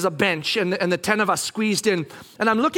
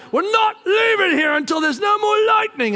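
high, loud laying down the law; exasperated shout; 10 semitones higher, 4 dB louder than usual. Retold or imitated. Dad overruling restless kids during storm, exasperated shout.

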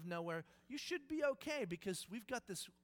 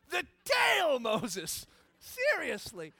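much louder, hammed-up exasperation; exasperated shout; 5 semitones higher, 12 dB louder, much slower than usual. God yelling at Moses for grabbing the wrong end. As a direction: bark it like a fed-up teacher. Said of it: "Does God just go".

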